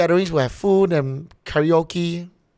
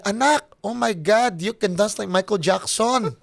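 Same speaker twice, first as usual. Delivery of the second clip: put-on higher-pitched mom with a thick Filipino accent; 4 semitones higher than usual. Son mimicking his mom urging him to dance, Filipino mom accent. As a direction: put on an excited Filipino mother. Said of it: "why you sound like Jokoy's mom".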